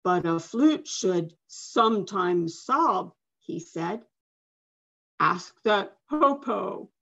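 lightly voiced old man, calm and slow; a hint of a gentle elder; wise old man. A narrator's reading. Medicine man advises girl, lightly voiced old man.

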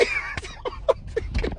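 high, breathless cracking up; squealing soft laughter; high helpless laugh. Real, not acted. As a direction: burst out laughing after the impression. Cracks up at own imitation.

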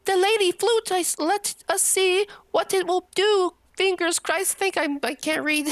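high put-on voice; character voice. Princess tries the flute, high put-on voice. Voicing character while reading.

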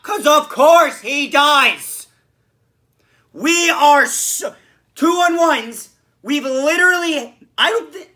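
high, loud, drawn-out frustration; exasperated rant. Real, not acted. Fan ranting about a botched two-on-one, exasperated. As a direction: rant loudly, stretching the words out.

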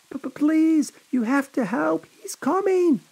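high, panicked breathless plea; gasped. A narrator's reading. Annette begging the hikers for help, panicked gasping plea. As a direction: beg between gasps in terror. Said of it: "Annette gasped".

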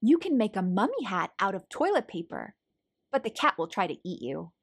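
plain reading voice

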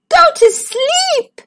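very high, slow, loud plea; pleading princess. Acted. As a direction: beg it, high and drawn out. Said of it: "she pleaded".

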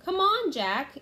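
high, loud impatient shout. A narrator's reading. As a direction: yell to hurry him along. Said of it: "Annie shouted".

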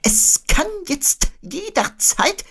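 very high, slow owl voice; resume the forecast very high and stretched; Eule. A narrator's reading. Owl trying to finish a forecast, very high and slow. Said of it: "erläuterte Eule".

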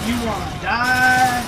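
taunting. Acted. Taunting enemy in game.

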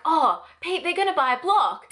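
high, loud eager news; high loud excited imitation; eager friend announcing. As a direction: call to his wife with excitement. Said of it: "he was like".

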